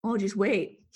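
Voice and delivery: ordinary delivery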